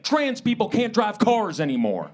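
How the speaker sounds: high, slow, mock proclamation; blustering Republicans. Comedian as Republicans laying down a crazy rule, mock-bossy. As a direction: declare it like an overbearing big brother. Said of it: "they'll just be like".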